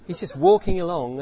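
ordinary delivery

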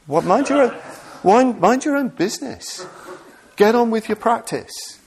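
hammed-up impatient scolding; mock exasperation. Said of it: "mock exasperated retort".

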